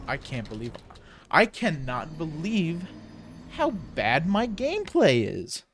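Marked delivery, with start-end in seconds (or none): 1.3-5.6 slow, drawn-out, higher-pitched dismay; exasperated disbelief. Real, not acted. Gamer lamenting their own bad gameplay, slow exasperated disbelief. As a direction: stretch each word in real disbelief, pitch climbing.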